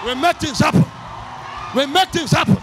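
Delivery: loud, high, driving sermon voice; preaching at full force. Acted. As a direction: hammer each line at the crowd.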